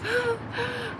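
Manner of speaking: a sharp, high gasp in mock shock; surprised gasp. Real, not acted. Reading a rude chat message, gasping in shock.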